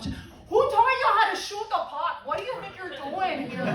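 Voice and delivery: high, strained mock holler; sudden sports-fan outburst, played for laughs; fan going off. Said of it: "I hear Bobby Lee going off".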